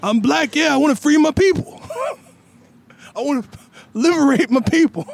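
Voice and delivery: movie scene voice. Acted. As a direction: declare it loudly like a movie hero. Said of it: "favorite scene from Lethal Weapon two".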